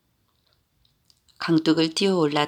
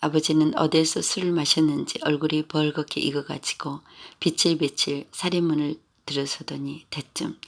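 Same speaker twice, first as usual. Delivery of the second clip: plain reading voice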